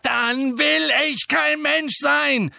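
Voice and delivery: high, loud, slow roar. A narrator's reading. Harry roars at Dumbledore that he doesn't want to be human. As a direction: roar it out in despair. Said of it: "brüllte Harry".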